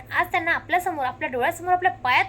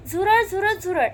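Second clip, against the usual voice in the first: higher, louder cry of alarm; raised mock-panicked call; alarmed shout; 4 semitones higher, 4 dB louder, slower than usual. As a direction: yell it like you've spotted a cockroach. [name] shouts about cockroach.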